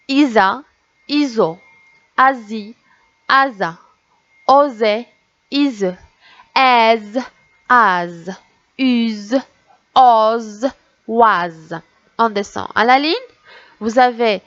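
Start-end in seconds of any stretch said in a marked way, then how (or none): 0.1-11.8 isolated syllables, each one distinct with a pause; syllable drill. Shown as a demonstration. Teacher reading syllables to children, measured and separated. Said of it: "on va lire les syllabes maintenant".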